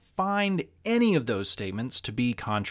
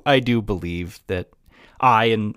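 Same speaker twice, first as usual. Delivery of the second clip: ordinary talk, nothing marked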